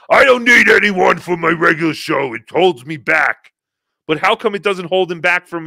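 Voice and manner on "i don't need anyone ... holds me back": loud mock protest; Stuttering John. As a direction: bellow it as a mocking impression, dragging the end. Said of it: "and why he says".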